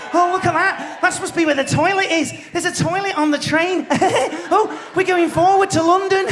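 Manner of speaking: high, giddy Northern day-tripper voice; mocking a wide-eyed Northern visitor, pitched high; excited Northerner. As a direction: gush like a tourist seeing London for the first time. Mimics excited Northerners on train.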